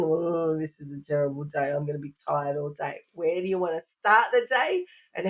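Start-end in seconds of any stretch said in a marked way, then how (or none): 0.0-3.0 low, soft, weary moan; gloomy grumbling. Acted. Parent mimicking a bad start to the day, gloomy and low. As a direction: act out a defeated morning groan.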